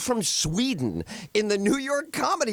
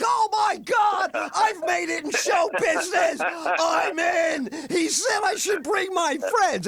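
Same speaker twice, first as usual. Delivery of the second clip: high, thrilled shouting, slow at the start; naive excitement; 12 semitones higher, slower than usual. Retold or imitated. Young comic thinks he made it, overjoyed shout. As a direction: gush like a kid who just got discovered. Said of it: "And I was like".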